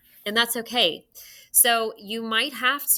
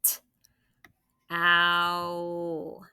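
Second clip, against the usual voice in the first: ordinary talk, nothing marked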